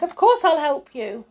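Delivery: high, loud panicky promise; panicked cry. A narrator's reading. Mister Mean to the washerwoman, crying in a panic. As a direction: blurt the promise, pitched right up. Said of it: "he cried in a panic".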